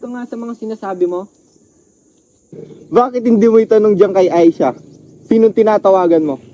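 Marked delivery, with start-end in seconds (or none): 2.9-6.4 loud, accusing questions; angry demand. Acted. Man confronts Aisha over who she is calling, angry demand. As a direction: confront her, voice raised and falling hard at the end.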